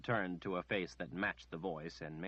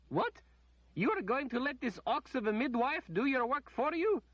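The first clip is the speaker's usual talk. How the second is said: very high, loud indignant outburst; the lady outraged at the midwife doing the work; 5 dB louder than usual. A narrator's reading. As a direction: scorn the midwife.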